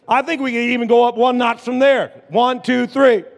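loud, high hype-up count-in; rallying shout. Real, not acted. Pastor urging congregation up a notch, rallying shout. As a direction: fire up the crowd and count them in.

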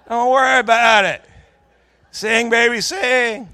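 high, loud, over-the-top belting; exuberant shout. Acted. Husband brushing off the unpaid hospital bill, exuberant holler. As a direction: holler it like a carefree showman. Belts out his catchphrase to wife.

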